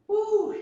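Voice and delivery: high whooping cheer; excited woo. Real, not acted. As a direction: whoop to pump up the class. Cheer between workout rounds.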